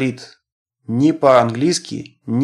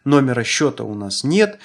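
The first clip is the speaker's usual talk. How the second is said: ordinary talk, nothing marked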